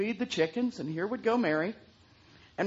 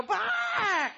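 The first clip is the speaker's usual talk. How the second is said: squawk; 12 semitones higher, 7 dB louder than usual. Acted. Chicken refuses lesson.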